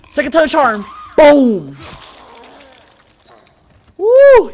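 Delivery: loud, high victory whoop; triumphant shout. Real, not acted. Player lands the second attempt on the monster, triumphant yelling. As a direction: whoop as the hit finally lands.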